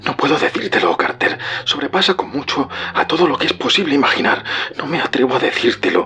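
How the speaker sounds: high, strained, desperate hush; horrified and despairing, pitched well up; fearful, despairing. A narrator's reading. Warren cannot tell Carter what he sees, fearful despair. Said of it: "enronquecida todavía por el miedo y ahora aparentemente teñida de desesperación".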